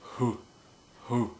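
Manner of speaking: slow, stretched hoo sounds; drawn-out hoo. Shown as a demonstration. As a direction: breathe out a long hoo. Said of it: "just practice the hoo".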